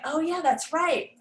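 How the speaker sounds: student realizing. Retold or imitated. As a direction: sound like a student catching on. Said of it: "they'll think".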